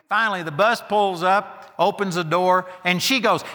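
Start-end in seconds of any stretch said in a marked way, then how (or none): none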